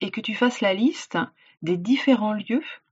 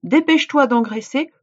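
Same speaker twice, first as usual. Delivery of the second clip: djinn's demand; 4 semitones higher, 6 dB louder than usual. Acted. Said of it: "répondit le djinn".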